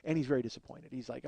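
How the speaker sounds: ordinary talk, nothing marked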